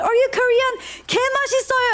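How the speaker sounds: high-pitched mock-pushy greeting; playful, high, forceful imitation; a little aggressive. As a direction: imitate an over-eager chef pouncing on a customer. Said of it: "A little aggressive".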